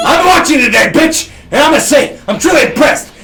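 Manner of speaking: loud, high brash Colonel; Colonel Sanders voice. Acted. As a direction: boom it out like a showman. Colonel Sanders character speaks.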